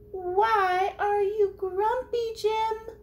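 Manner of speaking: very high, loud, slow lemur voice; squeaky lemur. Acted. Reading to kids, Lemur asks Jim why he is grumpy.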